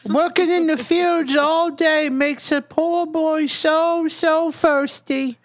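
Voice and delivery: put-on high, meek little kid voice; small child voice. Player's small child begging the bartender for water, pitched high and meek. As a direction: play it as a timid little boy asking.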